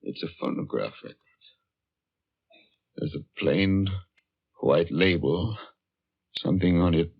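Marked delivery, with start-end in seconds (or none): 0.0-1.2 whispered fast under the breath; hushed whisper. Acted. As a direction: breathe it out in quick awe. Awed discovery of the record.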